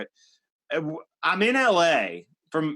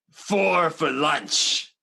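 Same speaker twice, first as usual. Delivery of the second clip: controlled rage; much slower than usual. Retold or imitated. Sean Penn holding back rage.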